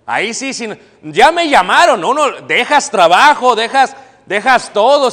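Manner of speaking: loud, high burst of excitement over being called; excited rush. Retold or imitated. Acting excitement when called. (emoción fuerte y aguda, gritando que ya lo llamaron)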